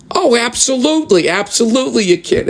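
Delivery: mock eager clerk. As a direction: flip to overeager yes. Clerk suddenly agreeable.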